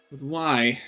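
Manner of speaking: ordinary talk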